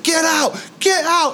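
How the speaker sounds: very high mock "get out" order; eerie ghost command. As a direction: chase him off like a spirit. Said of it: "it's like".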